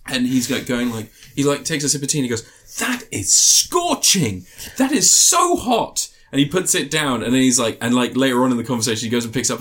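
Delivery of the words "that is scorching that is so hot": high, slow, drawn-out fussy complaint about hot tea; mock scalded complaint. Friend retelling Hugh Grant's tea scene, high drawn-out fuss. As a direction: stretch each word like a pampered toff burned by tea.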